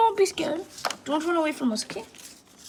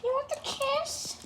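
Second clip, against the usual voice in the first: high cooing pet talk; 8 semitones higher, 4 dB quieter, slower than usual. Acted. Owner coos to pet bird.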